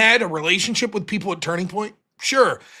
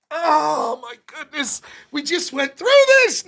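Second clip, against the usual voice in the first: exasperated; 6 semitones higher, slower than usual. Retold or imitated. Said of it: "And you're like".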